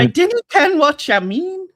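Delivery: playful fake Scottish accent; mock Scottish accent. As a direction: put on a teasing Scottish accent. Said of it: "[name]'s nearly got a Scottish accent".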